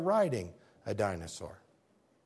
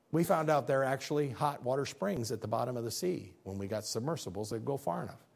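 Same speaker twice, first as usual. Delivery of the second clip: ordinary delivery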